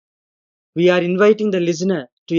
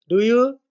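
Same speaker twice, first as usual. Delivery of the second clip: "do you" climbing high at the end; higher, questioning lift on "do you"; rising question tag; 6 semitones higher than usual. Shown as a demonstration. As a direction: ask "do you" with the voice going up. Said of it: "My voice has gone up".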